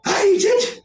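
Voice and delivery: high loud bossy hen; high hen voice ordering them indoors; gruff chicken hostess. Acted. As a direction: shout them inside like a scolding hen. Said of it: "and says".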